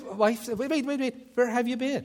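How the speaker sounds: high, urgent wait wait; alarmed wife. Retold or imitated. Wife startled by her husband's long absence, urgent. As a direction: stop him mid-sentence, startled.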